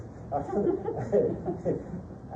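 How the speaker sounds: high-pitched laugh mid-question. Real, not acted. Questioner to lecturer, halfway into a question, laughing.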